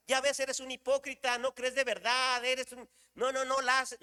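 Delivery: high, insistent mock scolding; acted reproach. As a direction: pile up accusations as an imagined scolding God. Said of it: "No es que Dios me esté reclamando".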